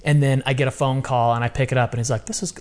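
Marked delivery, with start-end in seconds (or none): none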